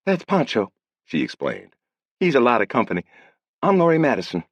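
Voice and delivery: put-on girl introducing the parrot; young woman. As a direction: introduce herself lightly.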